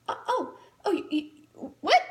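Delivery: startled stammer. Retold or imitated. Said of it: "I was so startled".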